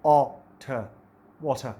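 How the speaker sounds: वाटर broken into ओ and ठ, then whole; pronunciation demo. Shown as a demonstration. Teacher showing students the sounds in वाटर. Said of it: "मसलन वाटर".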